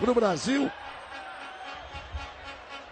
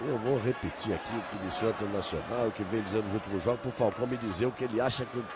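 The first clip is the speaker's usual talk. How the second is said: much lower, softer, quick aside; low quiet aside; 12 semitones lower, 6 dB quieter, faster than usual. Real, not acted. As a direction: drop to a quick side remark. (comentário baixo e rápido)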